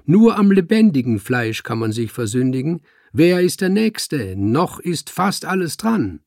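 high-pitched showman's call; called to the room. A narrator's reading. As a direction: drum up the next customer. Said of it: "rief der Zeremonienmeister in die Runde".

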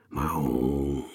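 a deep, drawn-out meow; low cat meow. Acted. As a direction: purr it out low like a tomcat. Kater miaut nach seinem Rat. (tiefes Katzenmiauen)

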